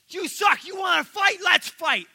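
high, loud picking a fight; angry shout. Retold or imitated. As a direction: scream a challenge to brawl. Proud leader picks a fight.

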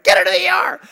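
high, loud urgent yell; imitating alarmed poison control; freaked-out shout. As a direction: shout a mock emergency order. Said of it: "had freaked out".

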